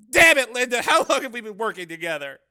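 loud, high angry protest; exasperated outburst. A narrator's reading. Cameraman confronts his longtime partner, exasperated outburst. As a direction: burst out in frustration at a partner. Cameraman loses patience with reporter.

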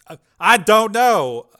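panicked stammer; stammering Dev. A narrator's reading. Dev cornered by the program, panicked.

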